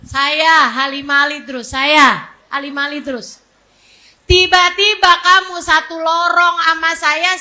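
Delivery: usual speaking voice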